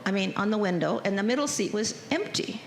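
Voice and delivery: ordinary talk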